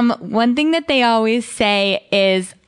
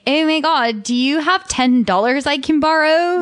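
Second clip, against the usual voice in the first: put-on Valley Girl voice, "Oh my God" pitched up; Valley Girl ask; 6 semitones higher than usual. Quoting people borrowing money, a lightly higher Valley Girl voice. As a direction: breezily beg for cash like a mall girl. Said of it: "people are also like".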